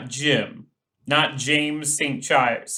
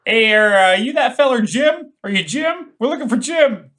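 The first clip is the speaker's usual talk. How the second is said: high loud backwoods holler; hillbilly calling; 7 semitones higher, 6 dB louder than usual. Acted. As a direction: call out like a rural yokel. Calling into woods in character.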